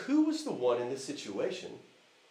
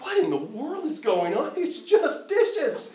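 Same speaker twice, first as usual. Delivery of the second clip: exasperated dad; 11 semitones higher than usual. Retold or imitated. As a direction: let the disbelief climb high and stretch out. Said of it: "a little exasperated here".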